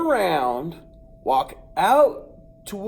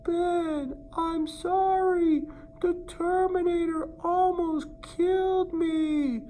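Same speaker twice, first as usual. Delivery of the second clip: much higher voice calling from afar; calling from far away; 13 semitones higher, slower than usual. Retold or imitated. The hidden cousin calls back to the boy from far away. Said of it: "call back to me".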